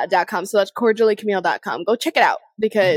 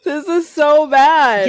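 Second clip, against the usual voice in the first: embarrassed laughing; 5 semitones higher, 4 dB louder, slower than usual. Real, not acted. Embarrassed after the blunder.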